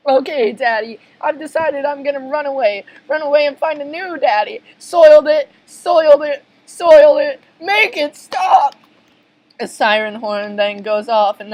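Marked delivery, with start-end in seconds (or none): 0.1-4.6 put-on higher girlish voice; petulant child to her dad; sulky daughter. Pearl threatens to run away. 4.8-7.4 high, loud broken-record wail; frantic repetition. Acted. SpongeBob keeps wailing his guilt. 7.6-8.7 high, drawn-out shout; agonized, stretched-out cry; desperate plea. Acted. Plankton can bear no more.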